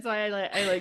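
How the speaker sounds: ordinary talk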